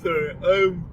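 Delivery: apology through a yawn; talking mid-yawn. Real, not acted. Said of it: "Sorry about all the yawning".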